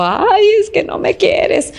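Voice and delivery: high, whining mock complaint; hammed-up whimpering "no me quieres"; whiny wife. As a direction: imitate a wife whining that she's unloved. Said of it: "ahí chillando".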